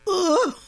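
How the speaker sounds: high disgusted grunt; disgusted ugh. Acted. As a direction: recoil with a loud ugh. Duchess reacts in disgust.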